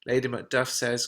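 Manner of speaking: plain reading voice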